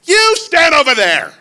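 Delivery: high, sharp order to an unwanted visitor; dismissive order. Acted. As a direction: send the poor man away. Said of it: "And you say to the poor man".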